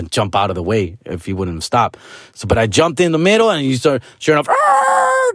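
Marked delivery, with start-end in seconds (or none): 4.5-5.3 very high mouthed screech; imitated tire squeal. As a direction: squeal like a car braking hard. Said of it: "that motherfucker squeaks".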